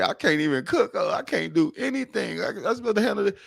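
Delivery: host mocks the crying woman's complaint, mild whiny parody. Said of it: "She said".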